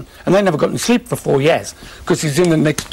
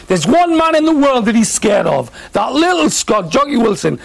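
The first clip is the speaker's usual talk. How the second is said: playful retold TV bluster; hyped-up telly bravado; 6 semitones higher than usual. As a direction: ham up the TV banter like a showman. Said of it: "saying on the telly the night before".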